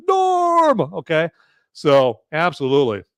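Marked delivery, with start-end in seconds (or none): 0.1-0.8 Cheers crowd greeting. Retold or imitated. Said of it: "everyone's like".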